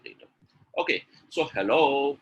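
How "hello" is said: drawn-out HELLO, swelling loud on the end; emphatic, lengthened hello; intonation demo. Shown as a demonstration. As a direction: stretch and push the second syllable of HELLO. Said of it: "Do you think the meaning is changing?".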